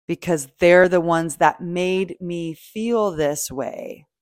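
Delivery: usual speaking voice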